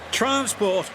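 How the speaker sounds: boasted. A narrator's reading. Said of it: "boasted Nelson".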